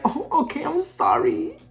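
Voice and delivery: put-on high, soft little-girl sorry; imitating her naive younger self, softly; meek naive apology. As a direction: apologize small and timid. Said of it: "naive me was like".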